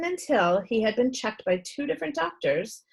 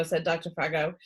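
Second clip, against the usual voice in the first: plain reading voice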